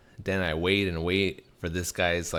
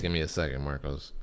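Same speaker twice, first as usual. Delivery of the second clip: ordinary talk, nothing marked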